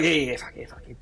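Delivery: hushed, breathy yes-yes; whispered cheer. Retold or imitated. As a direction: whisper it like a secret celebration. Old man quoted in rooms.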